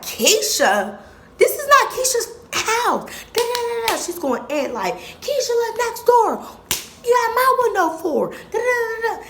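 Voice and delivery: very high, loud indignant mom voice; mom scolding. Retold or imitated.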